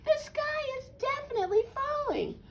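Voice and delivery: very high storybook hen voice; high-pitched hen; insistent hen. A narrator's reading. Hen insists sky is falling.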